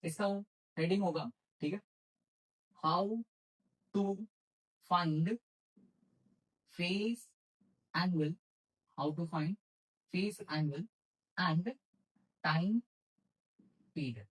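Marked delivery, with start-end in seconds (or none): 2.8-8.3 long pause after each word; word-by-word dictation. Shown as a demonstration. Teacher giving students a heading to write, word-by-word dictation. 11.4-14.2 softly spaced-out words with long pauses; slow dictation. Shown as a demonstration. Teacher finishing a heading for students to write, slow dictation.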